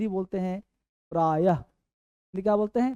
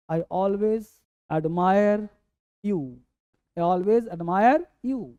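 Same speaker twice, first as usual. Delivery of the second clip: slow model sentence; much slower than usual. Shown as a demonstration. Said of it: "teacher models sentence slowly".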